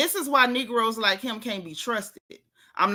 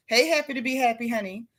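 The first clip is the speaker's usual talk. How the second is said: mocking imitation; faster than usual. A hint of mocking [name]'s mimicry of [name]. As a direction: tease it like a mimic.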